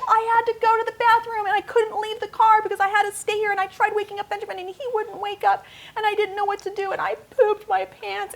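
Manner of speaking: put-on high, rushed kid's voice, upset; distressed child. Son explaining to his mom, high and upset. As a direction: spill it out like a panicked little boy.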